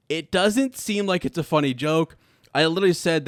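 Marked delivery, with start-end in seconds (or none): none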